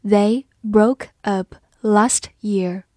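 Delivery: slow and hushed, word by word; slow reading. Shown as a demonstration. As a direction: read it slowly for learners. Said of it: "好，我们慢读一遍".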